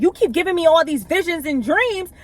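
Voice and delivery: put-on frustrated girl begging for answers, pitched high.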